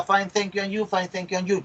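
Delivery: rote answer. Retold or imitated. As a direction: rattle it off as an automatic habit. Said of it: "Y siempre se contesta". (respuesta automática, repetida de memoria)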